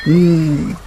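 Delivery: low very slow quiet hum and okay; grudging assent. Acted. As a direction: agree reluctantly, low and drawn. Witch grudgingly agrees.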